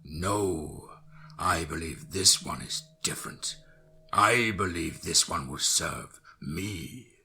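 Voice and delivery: low, very slow sinister count; ominous vampire voice. Acted. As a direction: purr it like a dark lord. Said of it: "an ominous voice said".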